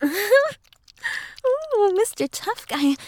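high, sing-song mock awe. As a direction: tease them with an exaggerated high "ooh". Mocking the roommate's bravado.

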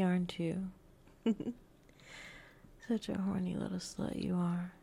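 ordinary delivery

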